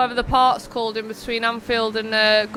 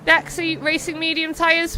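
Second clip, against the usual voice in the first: ordinary delivery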